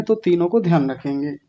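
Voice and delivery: usual speaking voice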